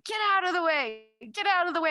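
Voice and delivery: hammed-up tailgater shouting, sped up; truck driver yelling. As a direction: bark the trucker's demand, fast. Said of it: "they're like".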